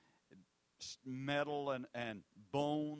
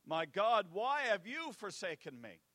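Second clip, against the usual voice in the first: loud, raised anguished cry to heaven; 6 semitones higher, 8 dB louder than usual. Acted. Jesus crying out loud to God, anguished cry. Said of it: "Jesus cried".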